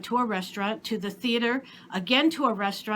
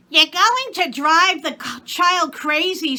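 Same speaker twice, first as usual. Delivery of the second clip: higher, louder exasperated maid; maid's voice; 7 semitones higher, 7 dB louder than usual. A narrator's reading. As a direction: maid complaining to Papa about the story. Maid scolds Papa.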